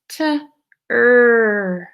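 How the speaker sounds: isolating sounds of tree; sounds separated. Shown as a demonstration. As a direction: say the t then a long er. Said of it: "What's the second sound?".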